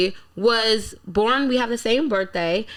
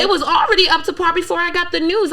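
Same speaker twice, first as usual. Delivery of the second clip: indignant; 7 semitones higher, 4 dB louder, faster than usual. Real, not acted. Defending her song to host.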